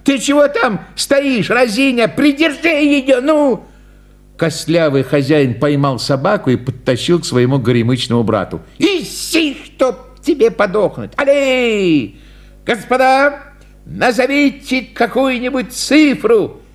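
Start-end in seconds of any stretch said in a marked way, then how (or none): 0.0-3.6 much higher, slow furious yelling; hammed-up frantic clown yell; angry shouting clown. A narrator's reading. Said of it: "закричал клоун". 8.8-16.6 much higher, strained barker call; hammed-up flustered showman; clown cursing. A narrator's reading. Clown curses dog, addresses crowd.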